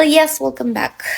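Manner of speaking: normal talking voice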